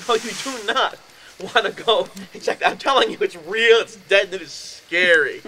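loud, insistent warning; urgent warning. Retold or imitated. Warning the neighbour not to check, loud and urgent. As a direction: stop him going, firmly and loudly.

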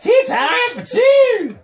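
slow, high whoop of a hillbilly host. Pretend. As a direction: holler it like a rowdy party starter. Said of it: "We can have a hillbilly tea party".

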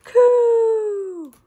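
high, loud pigeon coo. A narrator's reading. Unseen pigeon calling to Lulu, a loud high coo. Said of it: "somebody somewhere said".

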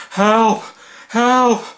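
high panicked shout; cry for help. Acted. Cornered victim screaming for help, high and panicked. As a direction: yell for help, terrified.

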